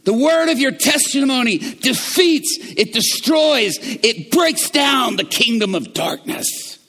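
loud, high, shouted preaching; preaching at full force. Real, not acted. Preacher to congregation, rising shouted proclamation. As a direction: build it into a thundering proclamation.

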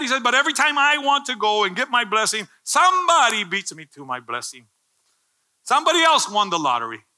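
loud, high preacher's cry; fiery preaching. Acted. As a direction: preach it out at full force. Preaching at full force.

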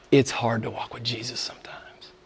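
a soft confiding whisper; preacher whispering a hard truth. Acted. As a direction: lean in and whisper it to them. Confiding aside to the congregation.

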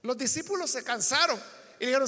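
ordinary delivery

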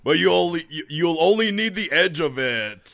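loud, high rally hype; announcer shout. Acted. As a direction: sell it like a truck rally ad. Loud commercial tagline.

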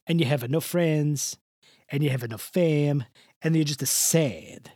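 put-on crooning old Italian charmer, laying it on thick; Mister Del Rossi. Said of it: "I gotta get the old man in here".

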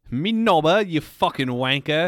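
loud, high British insult; hammed-up cockney yelling wanker; mock British accent.

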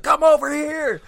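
high, loud action-hero yell; mock yell. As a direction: bellow it like Rambo. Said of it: "the guy was yelling and screaming".

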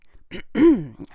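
loud attention-seeking throat clear; pointed throat clearing. Acted. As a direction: clear your throat to interrupt. Old woman seeks the royals' attention.

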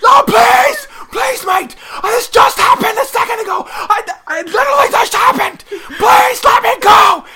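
high, loud, desperate English pleading shout; begging for his life. Retold or imitated. English player begging the hunting crew to spare him, shrieking high. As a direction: scream for mercy like you're about to be shot. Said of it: "he starts begging for his life".

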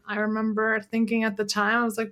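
ordinary talk, nothing marked

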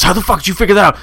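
loud, high-pitched mock freak-out. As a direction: imitate the rattled troll's outburst. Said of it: "He, like, freaked out".

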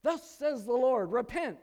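high, loud, booming prophet's cry; fiery prophet. Retold or imitated. Pastor mimicking a preacher calling to repent, booming. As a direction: thunder it like a street preacher. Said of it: "what are they doing?".

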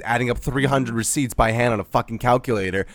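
ordinary delivery

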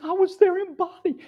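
"I was there in body" high, with a crack of crying; tearful cry. Real, not acted. Preacher insisting to the church, near tears. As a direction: let the tears break through. Crying, higher take of the phrase.

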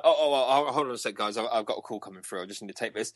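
ordinary talk

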